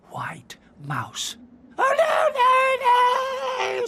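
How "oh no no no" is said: very high, loud terrified squeal; frightened squeak. A narrator's reading. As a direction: shriek in terror. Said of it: "squeaked Little Mouse".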